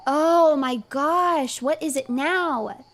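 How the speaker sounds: high, slow, dragged-out exasperation; exasperated drawn-out groan. Real, not acted. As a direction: drag every word, fed up. Fed up with interruptions.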